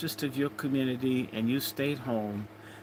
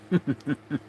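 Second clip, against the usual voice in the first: laugh. Real, not acted. Laughs at his own hair joke.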